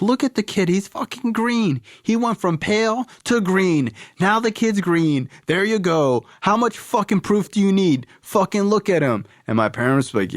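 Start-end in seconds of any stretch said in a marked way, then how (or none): 0.0-9.2 very high mock-outraged sister; sister's voice. Sister pointing at her green brother, high and mocking. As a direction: push her voice way up, indignant. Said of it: "she's like".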